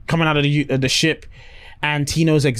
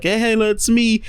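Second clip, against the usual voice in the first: higher, eager mock hello; cheery greeting; 7 semitones higher than usual. Skinny Man greets Donna, eager greeting. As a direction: greet an old friend brightly.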